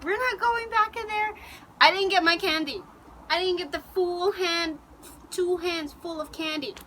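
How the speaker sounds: high, loud, indignant complaint; upset protest. Retold or imitated. Girl leaving the clown tent without candy, upset. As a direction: re-enact an upset kid's protest. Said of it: "I was just so upset".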